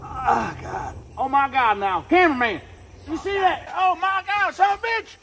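high, loud panicked yells; panicked shouting. Acted. Panicked sighting.

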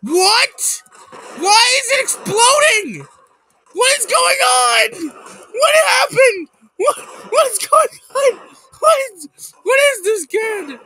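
high, loud bewildered yelling; real panic as the screen explodes; panicked shouting. Real, not acted. Gamer shrieking at an exploding opponent, panicked. Game chaos, repeated panicked questions.

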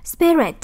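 SPI-rit, high first syllable then falling; stress on first syllable. Shown as a demonstration. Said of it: "âm một". (nhấn âm đầu của "Spirit")

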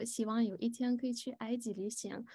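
ordinary talk

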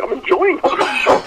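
slow, high, drawn-out plea; slow high whine. Retold or imitated. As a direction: stretch it out like a plea. Protesting the scam blocker.

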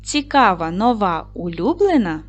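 measured, expressive reading of each phrase, pausing after every word. Acted. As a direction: read each phrase aloud to the class, clearly and expressively. Reads word groups aloud. (виразне читання словосполучень з паузами)

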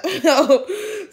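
short high burst of laughter; real high-pitched giggle. Real, not acted. Cracking up over tearing eyes.

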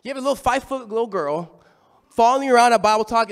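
ordinary talk, nothing marked

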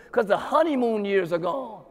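ordinary talk

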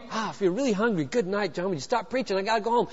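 soft aside, well under the voice; mock hungry churchgoer, quietly complaining; grumbling listener. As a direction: grumble it softly, wanting to leave. Voicing a hungry, impatient listener.

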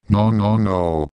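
ordinary talk

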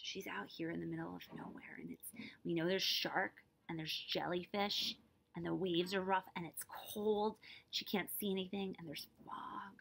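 soft, hushed eerie telling; spooky whisper. Real, not acted. Teacher telling children about the dark sea in a soft eerie hush. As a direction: hush it like a scary secret. Eerie aside while reading.

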